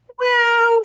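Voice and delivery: sudden high sunny lilt. A narrator's reading. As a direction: jump up into a bright chirpy key. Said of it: "her voice brightened to a higher key".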